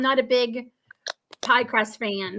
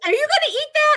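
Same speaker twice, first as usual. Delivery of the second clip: high, louder mock mom question; eager mom; 5 dB louder, much faster than usual. Mom spotting her daughter's leftover crust, high eager ask. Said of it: "My mother's like".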